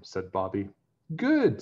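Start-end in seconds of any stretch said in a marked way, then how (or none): 1.2-1.6 mother's approval. A narrator's reading. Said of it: "said his mother".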